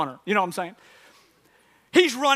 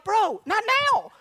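high, slow comic pleading; exasperated plea; 9 semitones higher, slower than usual. Retold or imitated. Winded runner to chatty neighbor, high exasperated plea. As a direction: drag it out to beg him off. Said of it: "I wanna be like".

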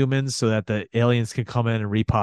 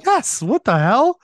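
sarcastic eager host; 7 semitones higher, 4 dB louder than usual. Retold or imitated. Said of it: "mocking Emilio's offer".

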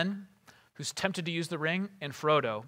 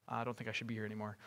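quick, very soft aside; small, backing-away murmur, hurried; Frodo shrinking back; 6 semitones lower, 13 dB quieter, faster than usual. Retold or imitated. Hobbit edging away from Boromir, shrinking back. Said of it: "starting to shrink back".

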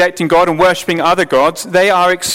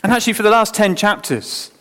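usual speaking voice

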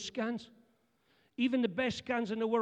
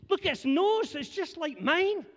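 gushing wife; 7 semitones higher than usual. Retold or imitated. Wife thrilled at the scan to her husband, high and gushing. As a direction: gush over the scan like a proud mum.

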